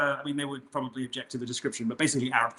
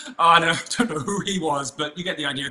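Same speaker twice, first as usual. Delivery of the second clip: ordinary talk, nothing marked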